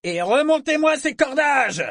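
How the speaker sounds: high, full-voiced shout to the crew; joyful bawling. A narrator's reading. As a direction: bawl the order with gusto. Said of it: "brailla joyeusement Sorkor". (braillement joyeux, aigu et puissant)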